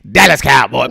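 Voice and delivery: shouted team cheer; hyped call. Acted. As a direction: call it out to the crowd.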